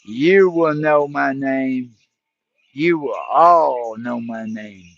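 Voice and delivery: low, slow, menacing threat; menacing stranger. Retold or imitated. Stranger warns the scared YouTuber in a low, slow menace. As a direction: let each word land low and cold, like a promise. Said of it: "And the guy said".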